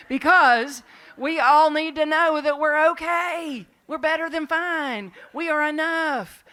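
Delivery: loud, high preacher's cry; rousing proclamation. Real, not acted. Preacher assuring the congregation they are okay, loud proclamation. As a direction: proclaim it at full force, drawn out.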